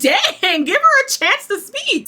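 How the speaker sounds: high, loud burst of disbelief; exclaiming reaction. Real, not acted. Host reacting to the couple's argument, exclaiming. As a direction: cry out in indignant surprise.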